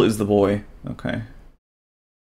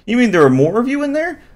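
put-on high, wondering boy voice; boyish surprise; 13 semitones higher than usual. Bubble asks the creature about others, amazed. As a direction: ask it amazed, like a kid.

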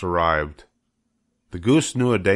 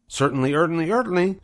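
much higher, stammering gander voice; fussy gander; 10 semitones higher, faster than usual. Acted. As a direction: repeat it in fussy bursts. Gander speaks.

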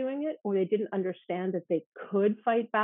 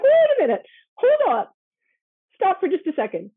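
urgent interruption; 10 semitones higher, 9 dB louder than usual. Retold or imitated. Urgently stopping student mid-story.

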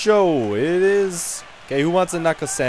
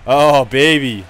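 ordinary talk, nothing marked

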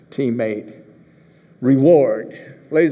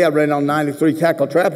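normal talking voice

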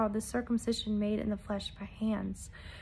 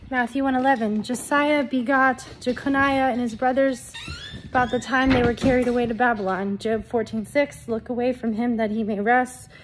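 loud, declaimed Bible verses; forceful scripture reading; 6 dB louder than usual. Real, not acted. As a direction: proclaim the verses to a congregation. Reads scripture aloud forcefully.

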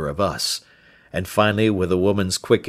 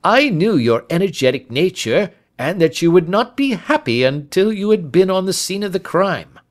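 high, louder inspector voice; emphatic police inspector; self-assured Lestrade; 11 semitones higher, 5 dB louder than usual. Acted. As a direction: speak up knowingly to a colleague.

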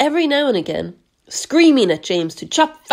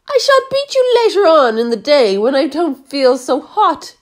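higher, louder menacing promise; threatening aunt; 6 semitones higher, 5 dB louder than usual. A narrator's reading. Aunt Spiker threatening James, louder and higher. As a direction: threaten the boy coldly. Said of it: "she said".

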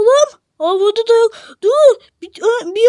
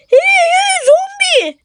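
calling out; 7 semitones higher, slower than usual. Acted. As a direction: call after the zombie. Calling to character.

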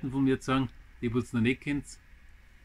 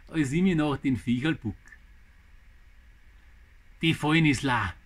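dramatic Bavarian verse recital in short phrases; poem recital; slower than usual. Acted. Gardener reads viewers his poem about a mouse, dramatic recital. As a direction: recite it line by line with pauses, lively rise and fall.

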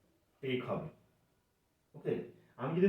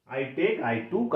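slow recap; much slower than usual. Shown as a demonstration. Teacher recaps the forms slowly.

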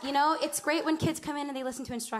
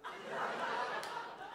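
long shocked gasp; imitated crowd gasp; 9 dB quieter than usual. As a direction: suck in air like a horrified audience. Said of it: "they're like".

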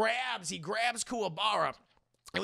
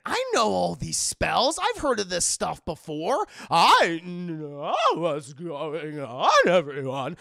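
loud, drawn-out master's pronouncement; old master; 3 semitones lower, 7 dB louder, slower than usual. Retold or imitated.

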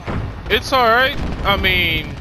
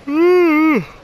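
long heavy sigh, falling; loud sigh; 13 semitones higher, 7 dB louder than usual. Real, not acted. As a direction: let out a big weary sigh. Tired gamer sighs loudly.

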